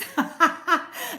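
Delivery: bright burst of real laughter; laughing at the viewer's comment. Real, not acted. Laughing as she reads Leah's favorite. Reading a funny chat comment.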